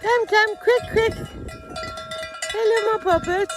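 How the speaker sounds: high sing-song calling; high cheerful come-come call to the cows; cooing to cows. Real, not acted. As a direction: coax the herd in a high pet voice.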